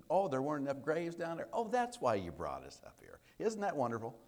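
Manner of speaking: mock-impressed, pitched high with sarcastic drops; smart-aleck sarcasm. Israelites griping about being brought out of Egypt, biting sarcasm. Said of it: "it sounds like a smart aleck".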